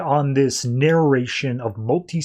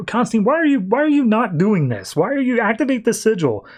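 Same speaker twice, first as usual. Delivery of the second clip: exasperated pleading; 9 semitones higher than usual. Retold or imitated. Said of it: "Fate's just like".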